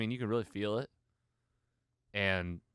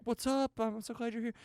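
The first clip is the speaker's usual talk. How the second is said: high voice; 14 semitones higher than usual. Retold or imitated. Nakia's greeting quoted in a high voice.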